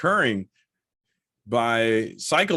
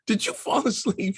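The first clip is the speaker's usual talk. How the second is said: higher, disbelieving question; incredulous accusation; 8 semitones higher than usual. Retold or imitated. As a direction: suspect the worst and ask it. Accusing wife of dozing off.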